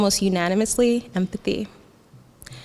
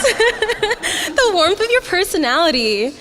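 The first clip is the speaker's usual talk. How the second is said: slow and higher, laughing through every word; 10 semitones higher, 6 dB louder, much slower than usual. Real, not acted. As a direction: keep giggling as you say it.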